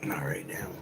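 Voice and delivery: soft secret whisper; whispered aside. Acted. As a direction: hush it like a secret. Answering own question, whispered.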